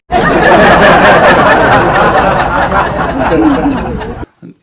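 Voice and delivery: big real laugh at his own joke; helpless laughter after the punchline; loud laugh. Real, not acted. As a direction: burst out laughing, loud and high.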